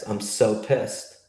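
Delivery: usual speaking voice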